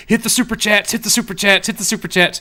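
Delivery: high, loud repeated nagging; imitating producer. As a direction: nag him to hit the chats. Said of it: "keeps texting me going".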